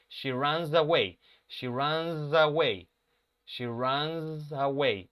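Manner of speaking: slow it down step by step, buzzing the Z into away. Shown as a demonstration. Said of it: "Ese sonido de la Z, ahí entre runs y away".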